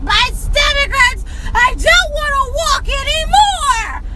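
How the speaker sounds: loud, high, drawn-out mock whine; whining child. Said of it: "wanting to whine".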